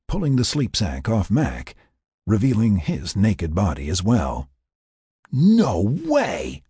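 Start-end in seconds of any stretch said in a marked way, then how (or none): 5.3-6.6 high, drawn-out exclamation of disbelief; exclaimed. A narrator's reading. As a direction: exclaim it in higher-pitched amazement. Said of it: "Laura exclaimed".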